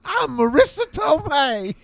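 very high-pitched, loud cartoonish impression; hammed-up squeal of an aunt's voice; absurdly high mock-aunt voice; Marissa Tomei. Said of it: "My Marissa Tomei impression is really good".

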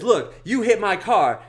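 loud, high and slowed-down mock accusation; in-your-face scolding. Lady up in driver's face, mock angry accusation. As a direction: press each word home as the angry woman. She goes up in his face.

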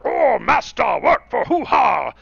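loud, high, hammed-up character shout; mock scream, drawn out at the end; screamed audition lines. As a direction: bellow it like a cartoon minion. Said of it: "screaming into the mic".

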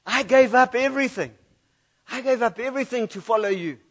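Peter insisting he gave it all; a hint of Peter's hurt pride; indignant protest. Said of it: "maybe he would have said something like this".

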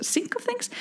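jumps way up in a doubtful rise; high puzzled echo of "Sync of things?"; questioning own phrase. Real, not acted. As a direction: catch yourself on a wrong idiom. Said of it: "to get into the hang of things".

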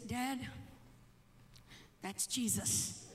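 low, soft retold reply; hushed answer. Son quietly telling father who it is, hushed. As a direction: break it to him quietly, slowing down. Retells gentle answer after shouting.

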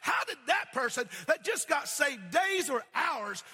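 a hurt, loud complaint about equal wages; upset worker. Acted. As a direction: protest the unfair pay. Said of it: "were upset".

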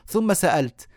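ordinary talk

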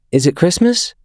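put-on high, drowsy child voice; a five-year-old just waking up; sleepy little boy. A narrator's reading.

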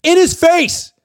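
high loud shout; yelling in his face. Retold or imitated. Reporter answering how Harbaugh yelled, shouting. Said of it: "or did he yell?".

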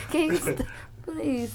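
high, soft plea through real laughter; too tickled to finish the words; helpless laughing. Real, not acted. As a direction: beg them to stop while cracking up. Still helplessly laughing, begging to stop.

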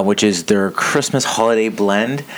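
ordinary talk, nothing marked

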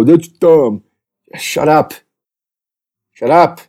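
hammed-up sneering fan; mocking whiny critic. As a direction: parody the complainers as dimwits. Mocking complainers again.

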